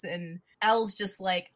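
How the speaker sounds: ordinary delivery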